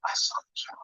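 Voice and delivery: whispering. Pretend. Cohost echoes the whisper.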